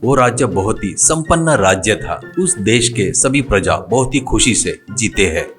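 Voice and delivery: ordinary delivery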